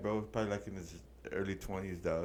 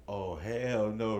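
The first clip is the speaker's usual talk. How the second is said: slow disbelief; much slower than usual. Retold or imitated. Reacting to guard setup.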